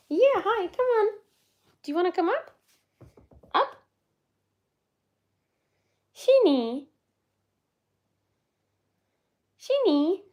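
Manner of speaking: high, loud, bright coaxing; calling a dog. Real, not acted. Owner invites the dog to come up, high loud coaxing.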